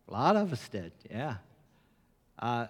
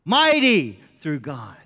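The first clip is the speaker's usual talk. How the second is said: louder, slow and emphatic; 8 dB louder, slower than usual. Shown as a demonstration. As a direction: proclaim it with weight. Quote repeated for emphasis.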